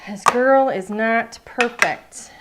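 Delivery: loud, slow self-mocking declaration; slow, emphatic. Real, not acted. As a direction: drag each word out, joking. Joking about her own mistake.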